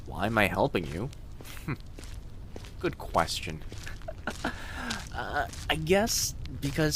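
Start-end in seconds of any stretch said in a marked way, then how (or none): 1.1-2.7 a quiet little laugh; soft giggle at why she helps; soft amused giggle. Acted. Laughs at the question. 3.7-5.1 a high, quiet little laugh; high soft giggle. Acted. Laughs again, higher.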